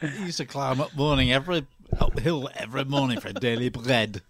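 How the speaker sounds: mock Lancashire advert voice, rolled R's; Lancashire accent. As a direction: imitate a Lancashire voice from an old advert. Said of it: "It's the Lancashire accent where they roll their R's".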